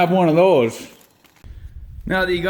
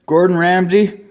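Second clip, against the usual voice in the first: ordinary delivery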